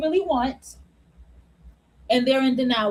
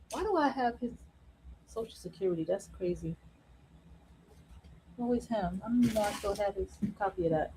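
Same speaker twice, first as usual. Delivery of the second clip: much softer, talking to herself; muttered aside; 5 semitones lower, 13 dB quieter, faster than usual. Real, not acted. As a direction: mumble it while looking at papers. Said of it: "muttered aside to herself".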